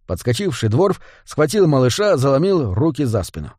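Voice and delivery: ordinary delivery, nothing marked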